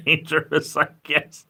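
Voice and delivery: high, chuckled words; laughing. Real, not acted. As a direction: laugh your way through the words. Laughs at own suggestion.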